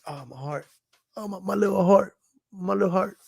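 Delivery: hammed-up wounded pout; mock hurt feelings. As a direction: play up a tiny broken heart. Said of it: "that shit hurt my feelings".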